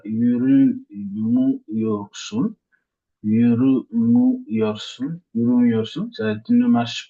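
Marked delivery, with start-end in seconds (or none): 0.0-2.5 yuru mu yorsun, slowly, syllable by syllable; slow Turkish demo. Shown as a demonstration. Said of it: "on dirait". 3.2-5.2 Yuru mu yorsun with the lift on Yuru, slow; stress before mu. Shown as a demonstration. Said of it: "on dirait".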